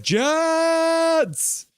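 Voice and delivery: long, high held yell; fan's team cheer. Acted. Streamer voicing a Jets fan in chat, long cheer. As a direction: stretch the team name way up high.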